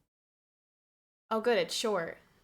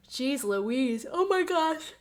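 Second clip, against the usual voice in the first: talking through a yawn, rising much higher; 3 semitones higher, slower than usual. Real, not acted. Streamer fighting contagious yawns, yawning as she talks. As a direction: let a yawn stretch the words.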